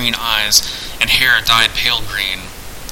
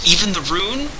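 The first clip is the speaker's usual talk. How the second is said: high, soft mock pleading; faked piteous; 8 semitones higher, 4 dB quieter than usual. A narrator's reading. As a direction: put on a pitiful whine. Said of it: "forcing a piteous note into his voice".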